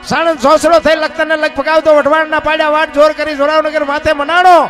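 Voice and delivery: loud, driving folk chant building from steady to full force; rhythmic poem recital. Acted. Folk performer chants Bhikhabhai's earthquake poem to the crowd, loud rhythmic chant. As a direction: pound out the verses like a bard over music. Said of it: "એ બાપુ એ અમારા ભીખાભાઈ રબારીએ લખ્યું છે".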